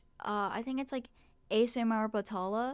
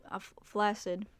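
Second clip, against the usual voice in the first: usual speaking voice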